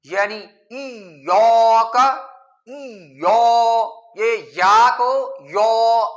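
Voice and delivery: یا said thick with rounded lips, slow and loud; heavy ya. Shown as a demonstration. Teacher demonstrates the heavy rounded ya mistake, loud. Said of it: "موٹا پڑھنا بھی ٹھیک نہیں".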